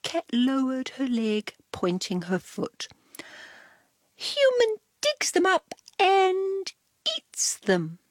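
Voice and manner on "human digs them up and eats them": high, smug cat voice. Acted. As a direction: reveal it like a proud cat.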